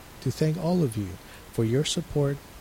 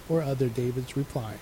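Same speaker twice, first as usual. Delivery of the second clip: ordinary talk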